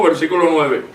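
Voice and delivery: ordinary talk, nothing marked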